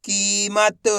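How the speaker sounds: whole word in one go, vowels held; syllables joined. Shown as a demonstration. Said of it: "तो मिलके बन जाएगा".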